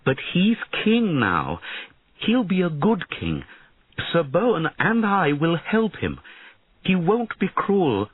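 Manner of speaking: a worried mother-queen begging for help; pleading queen. Acted. Queen argues for her son.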